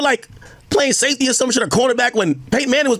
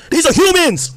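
raised, pushing the point hard; getting worked up; heated insistence; 5 semitones higher than usual. Real, not acted. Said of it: "Why, why Egg crashing out?".

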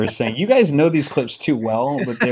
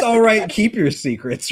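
mock wizard line; 7 semitones higher, slower than usual. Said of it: "it's just".

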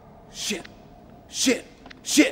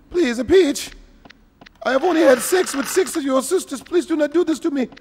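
high, pleading cartoon plumber; hammed-up plumber begging for mercy; Super Mario voice; 7 semitones higher than usual. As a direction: beg in a raised mock Mario voice. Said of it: "Super Mario voice".

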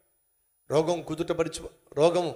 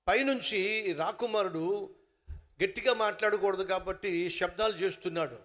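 normal talking voice

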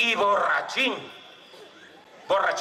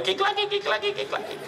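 high, rapid clattering syllables; imitated knocking knees. Teller mimics his knees before facing the bull, high clatter. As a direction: rattle it like trembling knees. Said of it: "empezaron a mí las rodillas".

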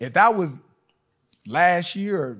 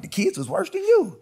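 daughter's voice. Retold or imitated. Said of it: "She was like".

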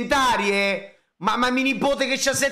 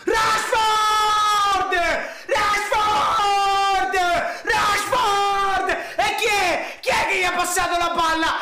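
frantic yelling; 10 semitones higher, 3 dB louder, slower than usual. Real, not acted. Yelling at screen during match.

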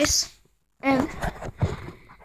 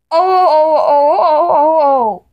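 long run of loud, joined-up ohs; loud wailing "oh"; 3 semitones higher, 8 dB louder than usual. Acted. Reacting to someone not listening, loud wailing. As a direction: wail out a string of ohs. Wailed outburst between scoldings.

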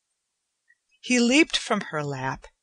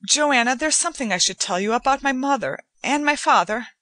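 faster, louder, blurting it out; rushed confession; 7 semitones higher, 4 dB louder, faster than usual. A narrator's reading. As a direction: let the words tumble out urgently.

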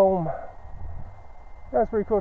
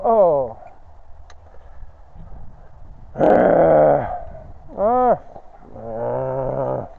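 grunting and groaning through deep snow; straining groans while trudging; effort grunt; 7 semitones lower, much slower than usual. Real, not acted. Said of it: "Sorry about the grunt and groaning".

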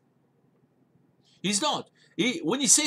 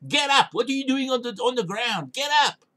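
commanding shout; 5 semitones higher than usual. Retold or imitated. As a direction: order him up off the floor. God rousing Joshua from ground.

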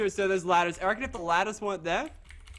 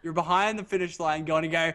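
ordinary talk, nothing marked

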